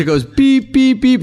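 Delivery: high-pitched cue beeps; squeaky countdown beeps; imitated beeps. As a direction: mimic electronic beeps, very high. Imitates looping beeps in story.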